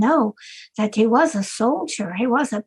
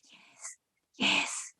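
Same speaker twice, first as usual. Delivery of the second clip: whispered yes; 13 dB quieter than usual. Acted. Secret reply.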